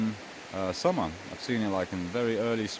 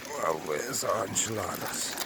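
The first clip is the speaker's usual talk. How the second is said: ordinary delivery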